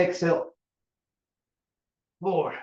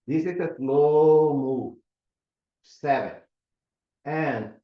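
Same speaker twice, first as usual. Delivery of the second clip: slow demo; slower than usual. Shown as a demonstration. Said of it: "you wanna go slow here".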